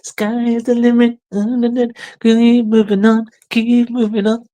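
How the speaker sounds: squeaky pep-talk voice, high and pushy; high mock coach voice. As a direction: cheer him on in a silly high voice.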